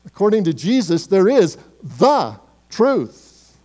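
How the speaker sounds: emphatic: THE truth, not a truth; stress on the truth. Acted. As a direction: land hard on "the truth".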